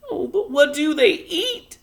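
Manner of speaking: high, loud crying wail. A narrator's reading. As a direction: wail it in distress. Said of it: "wailed Homily".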